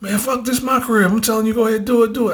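high-pitched, insistent imitation of a friend; retold fired-up insistence; worked-up urging.

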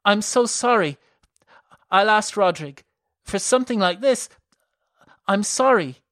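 high, loud distressed apology; upset sympathy. A narrator's reading. Malern comforting her bleeding sister, upset.

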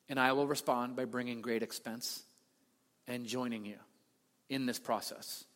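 soft, low and reverent, almost murmured; tender, hushed vow of loyalty; hushed devotion. Retold or imitated. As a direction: quietly pledge yourself to him. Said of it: "as if to say".

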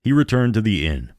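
ordinary delivery

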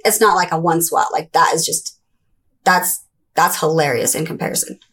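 normal talking voice

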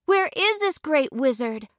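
put-on high girlish voice, earnest; young Dorothy. Dorothy quickly correcting the lady, high and earnest. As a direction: answer promptly and sincerely. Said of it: "replied Dorothy".

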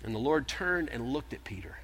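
hushed whisper. Acted. Preacher whispering as Jesus looks at Peter. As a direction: drop to a breath for the moment Jesus looks. Solemn climax of the denial.

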